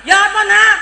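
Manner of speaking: high, loud mother's question; eager questioning. Acted. Mother asks son how it went, eager. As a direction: call out eagerly for the news.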